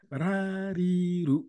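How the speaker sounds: ra ri ru, each syllable said slowly and clearly; model syllables for repeating. Shown as a demonstration. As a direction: say ra, ri, ru for the student to copy. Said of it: "Ok, on y va". (ra, ri, ru bien détachés pour faire répéter)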